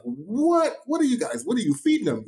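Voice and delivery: high, astonished "What?"; amazed college players. Retold or imitated. College drummers marvelling at his kids, high and astonished. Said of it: "they're like".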